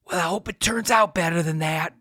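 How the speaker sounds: imitating a worn-out voice that barely comes out; Bill Clinton. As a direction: croak it like a candidate who has lost his voice. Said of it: "he says".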